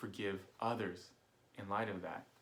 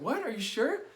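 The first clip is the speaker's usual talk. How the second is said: high, louder disbelieving friend; incredulous question; 12 semitones higher, 5 dB louder than usual. Retold or imitated. Buddy can't believe he wants the other side cut, high disbelief. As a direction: ask it in disbelief.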